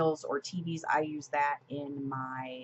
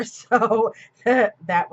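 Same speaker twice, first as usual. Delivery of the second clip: laughing through the words; 5 semitones higher, 12 dB louder than usual. Real, not acted. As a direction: breaks into a real laugh mid-line. Laughing at her own emoji love.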